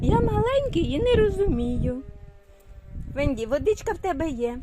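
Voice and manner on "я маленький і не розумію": put-on lightly raised little-child voice; voicing the baby. Mother speaking for her baby in a lightly higher little voice.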